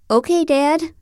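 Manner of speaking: teasing. Acted. Teasing John about ice cream.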